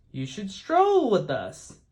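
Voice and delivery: proud, drawn-out invitation; lightly voiced peacocks, a bit grand. A narrator's reading.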